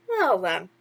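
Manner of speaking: chirpy sidekick asking what's wrong; a hint of cartoon mouse; lightly voiced mouse. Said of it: "Timothy concluded".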